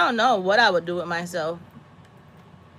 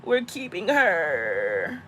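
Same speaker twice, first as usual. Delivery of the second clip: mock protest; slower than usual. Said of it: "I'm like".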